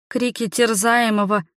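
usual speaking voice

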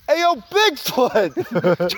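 slow, drawn-out mock call; bigfoot call. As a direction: stretch it like a lazy forest call. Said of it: "I'll do my Sasquatch call".

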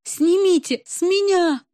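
high slow wailing plea. A narrator's reading. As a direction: howl it out, begging. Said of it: "взвыла Любочка".

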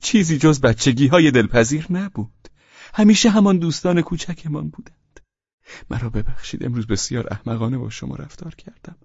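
high, sugary voice; sly sweetness; sly sweet smile. Acted. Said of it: "با بدذاتی لبخند شیرینی زد".

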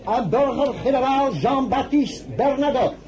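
formal herald call, loud and high; loud announcement. Acted. Servant announcing guests to the salon, loud and formal.

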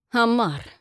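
angry. A narrator's reading. Angry outburst at traitor's name.

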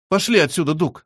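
plain reading voice

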